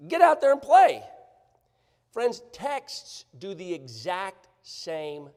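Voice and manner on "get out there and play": coach's shout. Retold or imitated. Coach sending kid back in.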